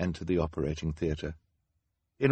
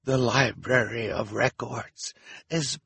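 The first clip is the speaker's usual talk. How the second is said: grave accusing Hal; 5 semitones higher than usual. A narrator's reading. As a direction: stretch out the dreadful news. Hal announces the fire.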